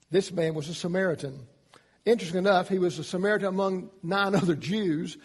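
ordinary talk, nothing marked

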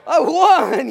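beaming boast at full volume; triumphant shout. Acted. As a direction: crow it like a proud kid. Kid triumphant after wrestling match.

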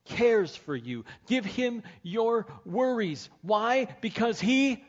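fervent preacher pleading with the hurting listener; full-force preaching. Real, not acted. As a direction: preach it like the room depends on it.

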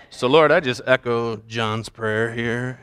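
low, slow pretend prayer; mock prayer. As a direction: intone a prayer to impress the group. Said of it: "use that the next time I pray".

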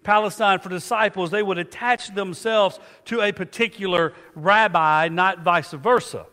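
preaching at full force. Acted. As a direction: lift the congregation with emphasis. Preacher rises again.

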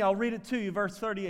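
ordinary talk, nothing marked

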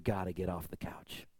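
sudden hushed whisper to the congregation. Acted. Preacher whispering to his congregation, hushed. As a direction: drop to an intimate whisper, as if sharing a secret.

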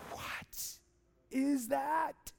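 hushed "what", then voice lifting; acted amazement. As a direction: marvel like an awestruck newcomer. Acts awed newcomer sensing God.

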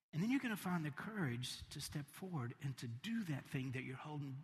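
hushed, gentle sermon voice; soft encouragement. Acted. Preacher gently encouraging listeners to just be, hushed. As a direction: draw them close, quietly.

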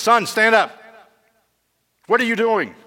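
loud, high commanding challenge; king rousing a soldier caught asleep, stern and loud. Acted. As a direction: bark it at the sleeping soldier. King confronts sleeping soldier.

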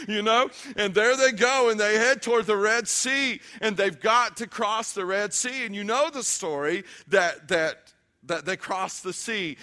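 emphatic, driving sermon delivery; impassioned preaching. Real, not acted. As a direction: press the point home to the congregation. Tells Red Sea story forcefully.

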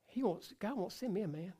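a small, plaintive put-on lament; mock crying complaint. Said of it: "You're crying".

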